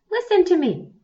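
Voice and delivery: put-on high, loud command from an old bear; Old Woman Bear orders Young Bear to listen, loud and high; ordering. A narrator's reading. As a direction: call out sharply, ordering. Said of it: "she ordered".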